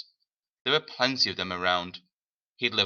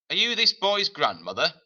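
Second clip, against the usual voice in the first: higher, accusing official's question; a lightly voiced stern gentleman; stern suited man; 8 semitones higher, 4 dB louder than usual. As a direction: challenge her like a cold official. Suited man challenges woman.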